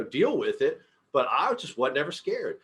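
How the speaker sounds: ordinary delivery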